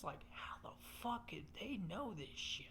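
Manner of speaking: spooked whispered question. Retold or imitated. Watching the videos, paranoid he's been spied on, whispered.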